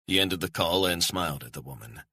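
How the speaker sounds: plain reading voice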